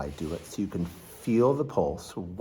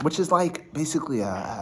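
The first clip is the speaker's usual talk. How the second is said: normal talking voice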